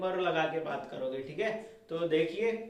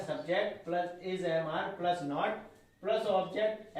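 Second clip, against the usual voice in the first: slow dictation; much slower than usual. Shown as a demonstration. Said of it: "rule dictated slowly".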